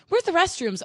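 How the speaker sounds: high-pitched mock customer question; imitated customer. Customer asking staff for the restrooms, mimicked high. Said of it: "have to be the customer service".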